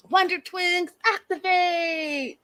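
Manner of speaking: high, slow heroic catchphrase; cartoon hero call. Shown as a demonstration. Vlogger recalling a cartoon line, drawn out like a hero. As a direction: call it out like a superhero. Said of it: "Where did I hear that?".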